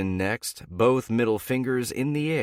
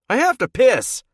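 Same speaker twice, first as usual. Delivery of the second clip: much higher, much louder angry yell; 11 dB louder than usual. A narrator's reading. Teammate yells through the bathroom door, loud and angry. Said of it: "someone yells into the bathroom".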